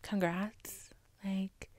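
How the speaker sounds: deadpan whisper. Acted. Deadpan aside to Elon.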